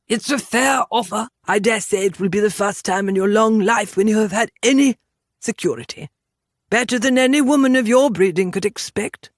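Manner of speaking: haughty gentleman making an offer; lofty, patronising character voice; condescending Englishman. Acted. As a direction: talk down to her as a superior Englishman.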